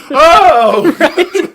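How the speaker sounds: very high, very loud wail of horror; hammed-up scream on the edge of laughter; mock terrified shriek. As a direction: imitate someone shrieking at a lifelong phobia. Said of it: "childhood phobia".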